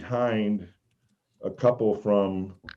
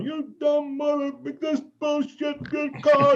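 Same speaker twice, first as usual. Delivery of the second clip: high, pitched-up heckling yell, dragged out slowly; mock yelling at the ref. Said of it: "but I'd be yelling".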